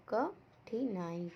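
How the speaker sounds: "कठिनाई" very slowly, a pause after क; syllable by syllable. Shown as a demonstration. Teacher showing the vowel sign to pupils, "कठिनाई" very slow and separated. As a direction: stretch "कठिनाई" out sound by sound. Breaks word into sounds.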